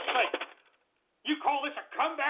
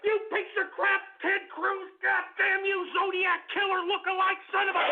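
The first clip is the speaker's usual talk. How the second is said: furious shout; 7 semitones higher than usual. Acted. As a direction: lose it completely at him. Host screams insults at Ted Cruz.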